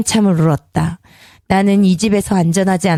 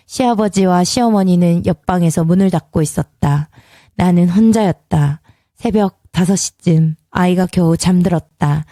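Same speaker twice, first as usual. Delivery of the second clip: plain reading voice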